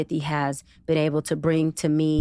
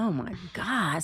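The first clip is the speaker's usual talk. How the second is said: soft, slow, fed-up "oh my God"; grudging groan under the breath; exasperated sigh; 6 dB quieter, slower than usual. Retold or imitated. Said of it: "I was like".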